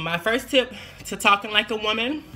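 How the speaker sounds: ordinary delivery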